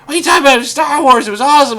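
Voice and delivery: high, loud imitation of indignant friends; mock fan outrage. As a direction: shout it like a fan defending a favorite. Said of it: "And they were like".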